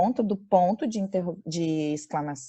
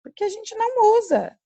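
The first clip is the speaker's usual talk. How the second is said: emphatic aside; 4 dB louder than usual. Real, not acted. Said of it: "emphatic aside".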